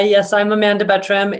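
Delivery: usual speaking voice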